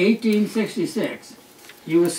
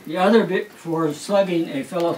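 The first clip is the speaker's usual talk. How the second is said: normal talking voice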